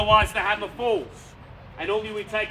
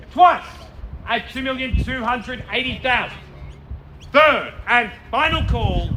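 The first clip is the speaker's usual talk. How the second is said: building auction climax; 3 semitones higher, 5 dB louder than usual. Real, not acted. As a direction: drive the room to the hammer. Final going once, twice, third call.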